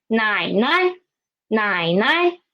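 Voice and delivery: teacher's model 奶奶, light second 奶 lifted. Shown as a demonstration. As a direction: dip on first 奶, step up on the second. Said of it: "It is higher because it is after a third tone".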